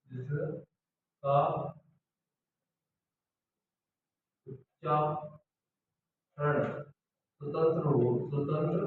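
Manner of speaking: very slow, word by word for taking notes; dictated word by word; slow dictation. Shown as a demonstration. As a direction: spell it out slowly for the class. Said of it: "स्वतंत्र रूप से हो".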